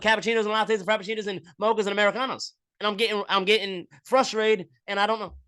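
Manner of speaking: high, loud, flustered announcement to the line; frazzled barista girl. Retold or imitated. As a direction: play the overwhelmed barista warning customers. Barista girl answers back.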